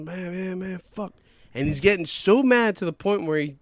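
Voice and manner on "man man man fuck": high, worked-up muttered cursing without extra volume; mock a guy freaking out, pitch raised; imitating freak-out. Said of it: "So he's freaking out".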